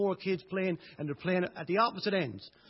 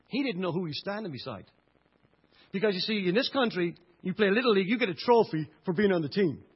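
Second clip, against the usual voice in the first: normal talking voice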